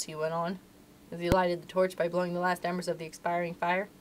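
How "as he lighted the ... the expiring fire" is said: plain reading voice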